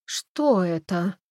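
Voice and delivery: very slow, stunned question; slow, bewildered ask; dazed. A narrator's reading. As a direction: drag the words out as if in a daze. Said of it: "заторможенно спросила Бренда".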